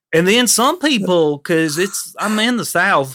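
slow, stretched Southern drawl; exaggerated Southern demo, drawn out; strong Southern accent. Shown as a demonstration. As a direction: lay the Southern drawl on thick. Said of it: "they really sound like this".

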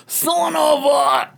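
drawn-out anguished scream. Acted. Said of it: "The dad screams".

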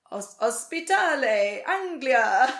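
quoting Marina's Czech to her brother; imitating Czech. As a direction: sound it out slowly in a Czech accent. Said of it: "she's like".

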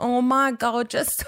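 put-on amused, clumsy British accent; hammed-up British imitation; attempted British accent. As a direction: copy the British speaker's accent, badly. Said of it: "I sound like an idiot".

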